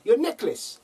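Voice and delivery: put-on "your necklace" in a high South African accent; mock South African "your necklace". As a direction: imitate the masseuse correcting her. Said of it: "It's all in the accent".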